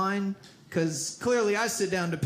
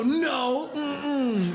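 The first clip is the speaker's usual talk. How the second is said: mock curt refusal; 3 semitones higher than usual. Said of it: "You don't just get to go".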